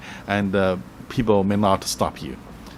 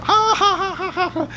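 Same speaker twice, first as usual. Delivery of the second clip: high, raucous mock laughing; high-pitched "ha ha ha" in imitation; happy crowd laughter. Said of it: "they're very happy when talk".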